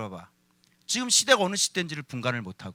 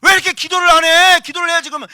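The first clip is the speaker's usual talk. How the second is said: 높고 크게 나무라는 흉내; 가족을 몰아세우듯 잔소리하기; 다그치는 흉내; 10 semitones higher, 15 dB louder, faster than usual. Retold or imitated. 가족에게 기도하라 다그침 재연. (다그치는 잔소리 흉내)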